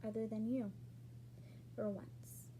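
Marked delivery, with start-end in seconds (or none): 1.7-2.5 whispered. Retold or imitated. Muttered remark before a retold quote.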